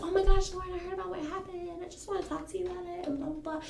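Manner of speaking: high, fast, hushed gush; imitated nosy acquaintance. Acquaintance comes up about the gossip, high fast imitation. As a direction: rush it out high as the eager acquaintance.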